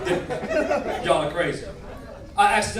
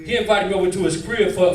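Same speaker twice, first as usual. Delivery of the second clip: normal talking voice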